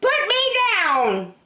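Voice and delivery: high, slow prim rabbit demand; a huffy rabbit insisting; high indignant rabbit; cross rabbit. Acted. Rabbit objecting.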